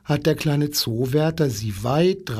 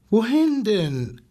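much higher, whiny plea; whining giraffe; 7 semitones higher than usual. A narrator's reading. Giraffe whining at the wolf, high and plaintive. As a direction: wail it high and helpless. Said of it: "jammerte die Giraffe".

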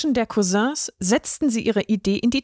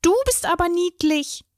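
high, sugar-sweet cooing at a cute bunny; sugary coo; 8 semitones higher than usual. A narrator's reading. Forest animals patting the bunny's head, sugar-sweet high coo. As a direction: coo it like petting a fluffy pet. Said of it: "zuckersüß säuseln".

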